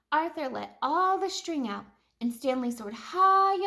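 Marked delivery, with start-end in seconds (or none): none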